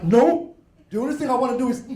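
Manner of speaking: high-pitched mock-angry refusal; acted anger. Angry person answering someone who says calm down, mock-angry. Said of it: "when you're really angry".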